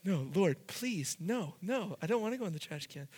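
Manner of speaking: hushed, fast, begging plea; boy pleading with the Lord while pinned down; mock pleading. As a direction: beg under your breath. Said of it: "I was like".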